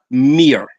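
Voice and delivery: "Mir" as one falling syllable; correct name pronunciation. Shown as a demonstration. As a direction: stop "Mir" on the r, no extra a. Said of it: "not Mira".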